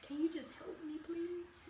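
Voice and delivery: soft, fading to a whisper on please; dumbfounded plea. Retold or imitated. Herself at work, pleading softly to be heard. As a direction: plead quietly, worn out and baffled. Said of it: "standing there dumbfounded like".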